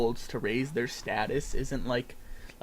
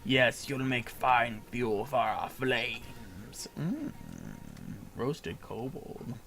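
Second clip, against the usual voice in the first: loud, slow gloating menace; menacing villain; 4 dB louder, slower than usual. Acted. Villain gloats about roasting the kobold, loud slow menace. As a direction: savor the threat of the flames.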